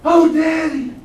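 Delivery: high, loud delighted cry; thrilled kid getting a new car; excited. Retold or imitated. Graduate thanks father for a car, high and excited. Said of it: "That's the kind of excitement".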